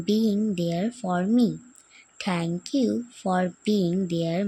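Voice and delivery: slow, loud letter reading. Real, not acted. Daughter reading her letter to mum aloud, slow. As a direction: read it out slowly, word by word. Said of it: "তোমাদেরকে পড়ে শোনাই".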